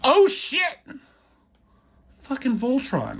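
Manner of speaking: high, loud gleeful yelp; excited. Real, not acted. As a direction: burst out thrilled at the reveal. Reacting to Voltron reveal.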